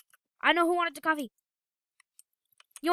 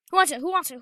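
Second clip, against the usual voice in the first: calling out loud to the room; 2 semitones higher, 7 dB louder, faster than usual. Acted. Gamer calling loudly to restaurant customers. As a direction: shout it to find the diner.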